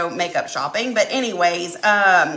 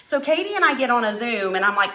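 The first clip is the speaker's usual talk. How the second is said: usual speaking voice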